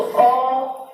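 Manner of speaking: long held अ sound; drawn-out vowel a. Shown as a demonstration. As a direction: hold the open अ very long. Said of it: "जैसे हमने बोला ना अ". (लंबा खिंचा हुआ अ)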